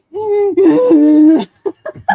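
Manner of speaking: loud sobbing laugh; laugh-cry. Retold or imitated. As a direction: break down laughing and crying at once. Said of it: "I'd be like".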